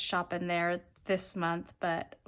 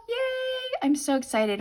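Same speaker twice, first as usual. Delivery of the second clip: excited. Real, not acted. Excited about Friday football.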